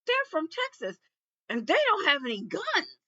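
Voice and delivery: high, loud call across the booth; yelling to a coworker. Retold or imitated. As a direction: shout the news over to your colleague in amazement. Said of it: "He yelled over to him".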